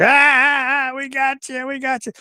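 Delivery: high, gloating laughter through the words; imitating pranksters crowing over him; mocking laugh. Terry and Chris laughing as they reveal the prank. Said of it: "they're both laughing".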